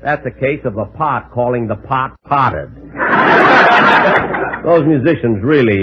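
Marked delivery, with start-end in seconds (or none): 3.0-4.6 laugh. Real, not acted. Laughs at own pun.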